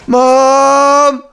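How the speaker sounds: high, drawn-out call for mom; little sister calling out. Retold or imitated. Little sister calling mom at night, high drawn-out call. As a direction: call out as a child wanting mom. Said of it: "do that over and over and over".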